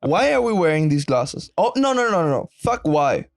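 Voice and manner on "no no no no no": ordinary delivery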